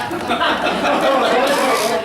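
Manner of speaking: bright burst of real laughter; a genuine amused laugh. Real, not acted. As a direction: laugh out loud at the joke. Laughs at spirit's joke.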